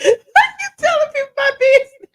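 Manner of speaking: high-pitched, loud laughing outburst; laughing protest. Real, not acted. Host to friend spilling her business, laughing protest. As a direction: protest through helpless, squealing laughter.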